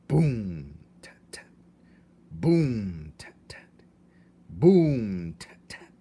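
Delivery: loud breathy boom, tiny tat tat; a mouse beating a thimble drum; drum imitation. A narrator's reading. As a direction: voice the drumbeat. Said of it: "began to beat the drum slowly".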